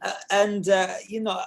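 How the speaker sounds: high, loud Greek restaurant owner; Sakis, Greek accent. Acted. Actor imitating the boss complaining about money, Greek accent. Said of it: "he is Greek".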